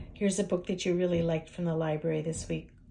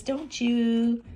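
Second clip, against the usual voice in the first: ordinary delivery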